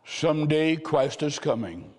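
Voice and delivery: ordinary talk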